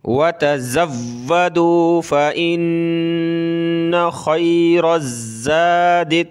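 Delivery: slow, drawn-out tajweed chant; slow Quranic recitation. Shown as a demonstration. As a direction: recite it melodically, stretching each vowel.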